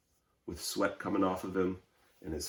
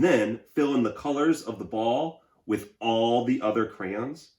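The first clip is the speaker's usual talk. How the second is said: louder, higher voice of a crayon sick of outlines; 4 semitones higher, 10 dB louder than usual. Acted.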